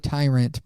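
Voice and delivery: "tyrant" with TIE as first syllable; long-i pronunciation. Shown as a demonstration. Admitting own inconsistency to co-host, "tyrant" as TIE-runt. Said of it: "but then I call it".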